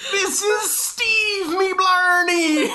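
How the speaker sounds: very high, stretched Irish shout; shrieky cartoon Irish announcement; Irish accent. Shown as a demonstration. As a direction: holler it in hammed-up Irish, vowels drawn out. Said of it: "someone with an Irish accent".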